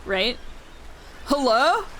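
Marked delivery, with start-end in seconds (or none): 1.3-1.9 a hint of himbo; lightly voiced dude bro. Trying out the character's voice, mild himbo. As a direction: try on a simple dude-bro greeting. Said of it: "like, a dude bro".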